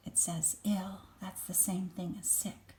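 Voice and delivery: hushed explanation; whispered aside. Real, not acted. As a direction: whisper it to the listener. Aside to child while reading.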